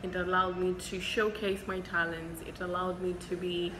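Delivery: usual speaking voice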